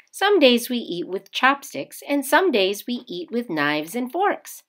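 ordinary delivery, nothing marked

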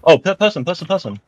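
high, loud, stumbling alarm; startled. Real, not acted. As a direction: blurt out the warning in alarm. Startled spotting an enemy.

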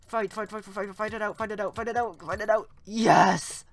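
high, loud, rapid-fire chanting; shouted fight chant ending in a victory cry; frantic battle yell. Real, not acted. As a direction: yell it urgently, bursting into triumph. Urging himself on, then winning.

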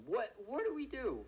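high, loud panicked question; alarmed cry. Real, not acted. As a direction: blurt it out in fright. Lost the suspect.

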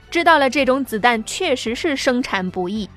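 ordinary delivery

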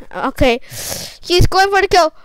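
ordinary delivery

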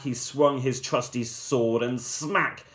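ordinary delivery, nothing marked

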